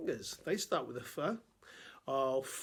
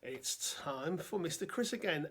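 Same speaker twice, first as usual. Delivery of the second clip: normal talking voice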